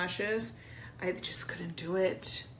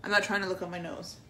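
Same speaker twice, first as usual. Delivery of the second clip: ordinary talk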